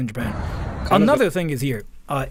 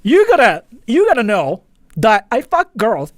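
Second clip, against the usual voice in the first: loud, high hammed-up bragging voice; over-the-top look-at-me voice; mock obnoxious announcement; 4 semitones higher, 8 dB louder than usual. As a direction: yell it out like a show-off forcing it on everyone. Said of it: "if you never just do".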